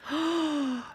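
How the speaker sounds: a quiet, high swooning gasp; gasp, smitten; soft gasp. Real, not acted. Swooning over the scene.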